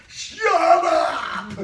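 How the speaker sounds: loud, high-pitched scream at a class; demonstrated teacher outburst, voice shooting up. Shown as a demonstration. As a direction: scream it from the top of the desk. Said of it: "stood up on the top of the desk and screamed".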